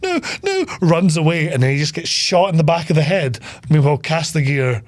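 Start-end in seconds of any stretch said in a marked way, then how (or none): 0.0-0.6 tiny, very high squeal; mock terror in falsetto; panicked manservant. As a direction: squeak it like a frightened servant. Manservant acted, told to run.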